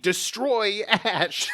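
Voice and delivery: slow, high hammed-up villain order; mock villain command. Podcast host voicing villain Damon, hammed-up and slow. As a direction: bark it like a cartoon villain.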